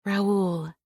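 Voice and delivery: ordinary delivery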